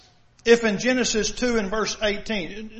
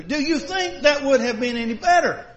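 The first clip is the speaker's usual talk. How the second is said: ordinary delivery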